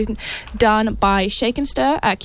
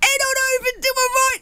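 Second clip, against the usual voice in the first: fast, high hammed-up British accent; quick jokey British accent; mock British accent; 14 semitones higher, faster than usual. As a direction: rattle it off in a silly British accent. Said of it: "I'm gonna stop doing the accent".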